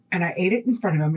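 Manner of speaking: ordinary delivery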